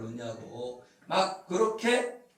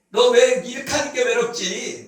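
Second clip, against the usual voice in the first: louder, higher accusing question; harsh reproach; 4 semitones higher, 7 dB louder than usual. Acted. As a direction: scold the lonely one. Acted harsh judgment of lonely person.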